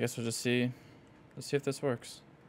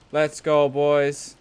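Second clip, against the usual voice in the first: frat bro; 8 dB louder, much slower than usual. Real, not acted. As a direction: shout it to the squad. Cheering after raid success.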